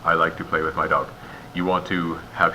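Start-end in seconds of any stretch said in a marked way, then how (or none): 0.0-1.1 all words joined, no gap anywhere up to "dog"; one unbroken chunk from "I" to "dog"; no pauses. Shown as a demonstration. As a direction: run "I like to play with my dog" together in one breath. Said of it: "or no pauses".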